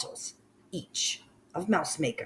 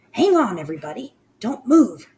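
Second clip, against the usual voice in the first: fast, loud, high urgent call; urgent cry; 7 semitones higher, 8 dB louder, faster than usual. Acted. Mildred orders everyone still, fast and loud. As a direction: rush it out to freeze the room. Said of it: "cried the mitch called Mildred".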